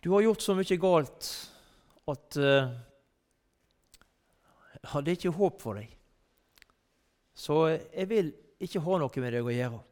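mild mock scorn, pushing someone away; a hint of a self-righteous judge refusing a sinner; cold rejection. Preacher voicing a judge who rejects a sinner, mildly scornful.

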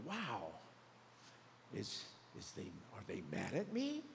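low, hesitant mock bewilderment; taken aback. Acts taken-aback person after exhortation.

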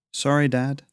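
plain reading voice

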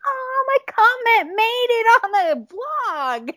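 very high, drawn-out squeal of delight; retold giddy fan freak-out; flustered fangirl. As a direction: squeal it slowly, overwhelmed to be noticed. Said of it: "my face is turning red".

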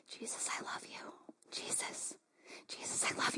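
soft unvoiced whisper of devotion; whispered prayer. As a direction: breathe it like a sleepy bedtime prayer. Retold sleeper praying to Jesus.

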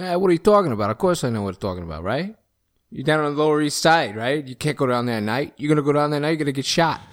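put-on lightly done New York cabbie accent, streetwise; a hint of a New York cab driver warning a tourist. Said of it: "now we have a cabbie".

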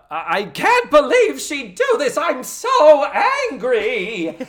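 high, loud hammed-up fury; full-throttle mock upset. Recapper acting upset Missus Garrett, full-throttle mock anger. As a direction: wail it high like an overwrought sitcom matron. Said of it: "We are at full throttle".

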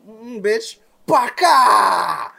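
high, very loud screeched attack; mock attack cry. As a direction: build from sassy hum into a drawn-out yell. Acts out Scarlet Witch's attack.

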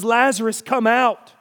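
"Lazarus, come out" called loud and high; commanding call. Shown as a demonstration. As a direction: call him out of the tomb with command. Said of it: "we hear in his voice the very directions".

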